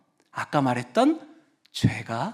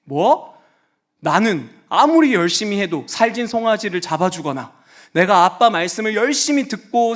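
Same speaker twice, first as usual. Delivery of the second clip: put-on indignant older son; 3 semitones higher than usual. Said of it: "두 번째".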